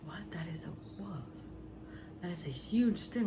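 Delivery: quiet, stunned reaction; softly taken aback; hushed disbelief. Retold or imitated. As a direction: drop the voice in retold awe. Retells seeing a huge wolf.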